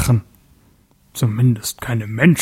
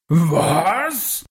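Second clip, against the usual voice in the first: roaring; 4 semitones higher than usual. Acted. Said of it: "brüllte er".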